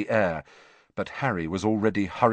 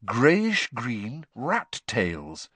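ordinary delivery

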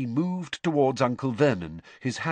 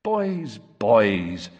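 vague; 4 dB louder than usual. Acted. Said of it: "said Mister Weasley vaguely".

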